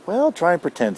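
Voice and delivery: snapping Effie. A narrator's reading. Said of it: "snaps Effie".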